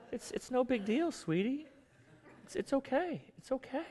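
calm him down gently, like a wife soothing her angry husband. Retold or imitated. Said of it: "very calm, pleasant ... state of just calm".

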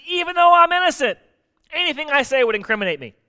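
high, loud cry of innocence; protesting Job. Acted. Job insists he is innocent, exasperated protest. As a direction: protest it, voice pitched right up.

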